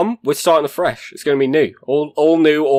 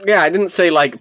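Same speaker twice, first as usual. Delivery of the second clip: ordinary talk, nothing marked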